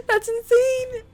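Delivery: high, loud shrieking laugh; incredulous laugh. Real, not acted. Gamer laughing at Thomas's insult, incredulous. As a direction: laugh in disbelief at the screen.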